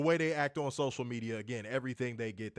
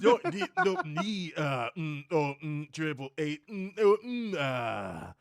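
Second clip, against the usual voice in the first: garbled glitchy voice; 3 dB louder than usual. Retold or imitated. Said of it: "All I heard was".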